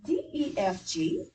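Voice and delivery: D, E, F, G with the voice rising up on G; question intonation. Shown as a demonstration. Teacher models a question mark for class, rising question tune. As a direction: read the letters as a question, lifting at G. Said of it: "I got a question mark".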